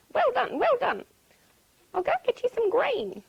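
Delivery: high, loud delighted duck; excited high-pitched congratulations; praising duck. A narrator's reading. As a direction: cheer on a friend as a duck.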